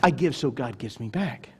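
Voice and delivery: low, quiet mock reasoning; understated mock of a transactional giver; pagan quid-pro-quo giver. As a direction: toss it off quietly, well below preaching pitch. Voices pagan giver's attitude.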